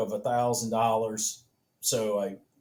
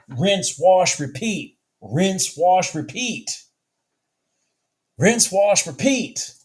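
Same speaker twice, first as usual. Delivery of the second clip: higher and louder with each rinse wash repeat; real mounting excitement; rising amazement; 8 semitones higher, 5 dB louder, slower than usual. Real, not acted. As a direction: build disbelief as the pattern repeats. Marvelling at repeating chart pattern.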